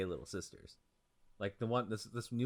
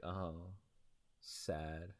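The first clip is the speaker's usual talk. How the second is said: low, soft mock sorrow; understated, pouty little "sad"; 5 semitones lower, 12 dB quieter than usual. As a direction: retell a small sad reaction, dropping low and quiet. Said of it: "And I was like".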